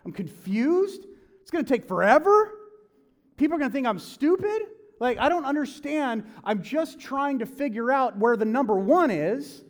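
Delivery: high, loud, strained griping; high-pitched exasperated rant; frustrated complaint. Retold or imitated. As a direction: whine it out, overwhelmed and confused. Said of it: "you're like".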